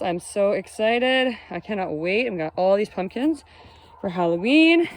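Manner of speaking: ordinary delivery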